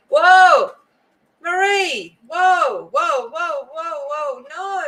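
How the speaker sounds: high, loud cries of surprise that fade quickly; overwhelmed by a viewer's gift, caught off guard; startled whoa. Real, not acted. Streamer caught off guard by Marie's gift, startled high cries.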